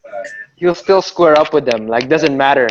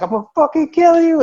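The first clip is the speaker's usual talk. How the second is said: imitated yelled threat; 12 semitones higher than usual. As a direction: mimic a friend's threat in a raised pitch. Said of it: "they're, like, yelling at each other".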